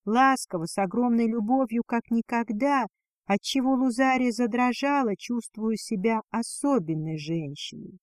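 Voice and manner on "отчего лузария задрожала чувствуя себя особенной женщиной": ordinary delivery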